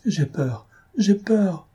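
high, plaintive repeated cry; frightened, plaintive little voice; panicked whimper. A narrator's reading. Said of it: "répétant d'une voix affolée et plaintive".

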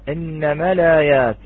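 ordinary talk

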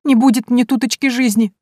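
put-on high, tearful lament of a servant girl; plaintive whine. A narrator's reading. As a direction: wail it on your knees. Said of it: "плаксивым голосом".

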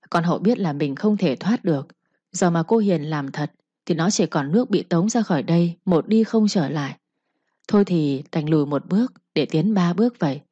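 ordinary delivery, nothing marked

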